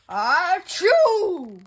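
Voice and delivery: a very loud, explosive fake sneeze. Gerald sneezing at Doctor Cat, loud sneeze. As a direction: sneeze it out big.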